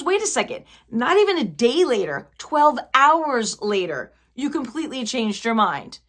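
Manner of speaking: imitating an incredulous, wronged woman; retold indignant outburst. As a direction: act out her catching him out, punching each point. Retold breakup confrontation, correcting herself.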